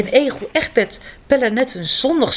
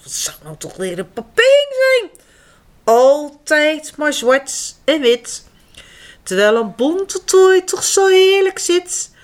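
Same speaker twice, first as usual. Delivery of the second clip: bird voice; 6 semitones higher, 4 dB louder than usual. Acted. Costumed bird chatters.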